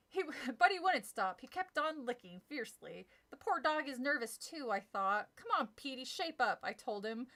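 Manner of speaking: lively storybook reading, voicing the scares; animated read-aloud of a children's horror story; story narration. A narrator's reading. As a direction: read it like a spooky kids' book to an audience. Reads Goosebumps chapter aloud.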